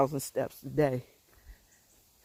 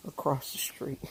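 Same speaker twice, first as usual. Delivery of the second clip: whisper; 4 semitones lower, 8 dB quieter than usual. Real, not acted. Pointing out neighbor's house.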